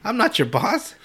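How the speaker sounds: a hint of an annoyed heroine; mock-exasperated Kagome. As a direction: push back, baffled. Kagome refusing the bandits.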